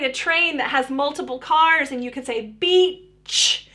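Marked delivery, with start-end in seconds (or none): 2.5-3.7 "beach" with a long vowel, then a separate final "ch"; ending sound set apart. Shown as a demonstration. Therapist demonstrating a train's last car, final "ch" in "beach" set apart. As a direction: stretch "beach" and pop the "ch" at the end. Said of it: "working on those ending sounds".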